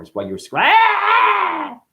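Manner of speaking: a sudden high shouted "ah!"; imitated newborn scream. Acting out a newborn, high shouted scream. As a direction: break off into a baby's scream.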